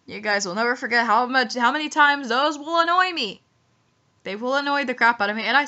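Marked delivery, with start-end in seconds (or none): none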